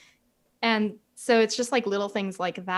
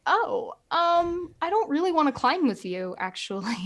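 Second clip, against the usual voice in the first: higher, hesitant retold reply; uneasy polite refusal; 7 semitones higher than usual. Climber politely declining to climb with a coworker, uneasy.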